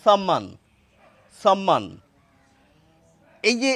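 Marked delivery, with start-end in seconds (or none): 0.1-0.4 সম্মান said as "sam-man"; hissed s at the start of সম্মান; dental s pronunciation. Shown as a demonstration. Teacher reading another written variant, সম্মান as "sam-man". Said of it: "দন্ত স, দন্ত সা". 1.4-1.8 সম্মান said as "sam-man"; dental s pronunciation. Shown as a demonstration. Teacher repeating the variant for viewers, সম্মান as "sam-man". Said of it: "দন্ত স, দন্ত সা".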